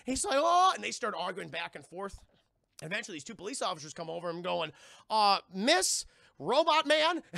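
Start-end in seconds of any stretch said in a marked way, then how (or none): 0.4-0.7 high, loud gasped "oh"; hammed-up outraged exclamation; mock shocked "oh". Man told he was lied to before, mock shock. 5.1-7.2 high, loud, slow official address; mock police officer. Officer approaching arguing pair, mock cop voice.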